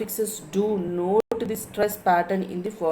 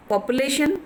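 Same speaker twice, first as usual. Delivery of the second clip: population with a higher, stressed LATION; 5 semitones higher, faster than usual. Shown as a demonstration. Teacher showing students the stress, population with LATION lifted. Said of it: "lation is stressing".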